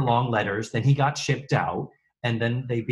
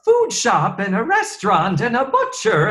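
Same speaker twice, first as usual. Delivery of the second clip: mock proud; 9 semitones higher, 5 dB louder than usual. Mocking the restaurant's claims.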